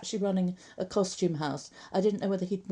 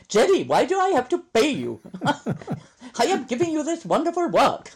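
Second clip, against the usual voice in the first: loud, laughing Indian accent impression; imitating a stingy boss, laughing; 8 semitones higher, 7 dB louder than usual. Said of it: "Ishmael was quite tough on the money".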